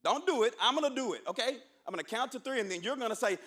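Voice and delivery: normal talking voice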